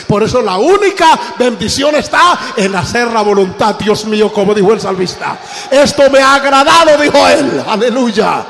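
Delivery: building from loud to a high, ringing shout; fiery pulpit climax; preaching at full force. Acted. Sermon climbs to its climax.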